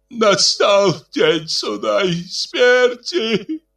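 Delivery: высокий, медленный стон; протяжно простонать о конце света; стонущий голос. A narrator's reading. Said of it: "послышался стонущий голос". (стонущий голос)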